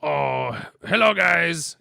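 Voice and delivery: high, loud goofy cartoon greeting; Strong Bad voice. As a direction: greet like a cocky cartoon masked wrestler. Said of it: "kinda had that voice".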